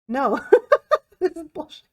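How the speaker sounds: a high burst of laughter breaking into the words; laughing through the refusal, high-pitched. Real, not acted. Tarot reader refusing the idea of casual energy, laughing.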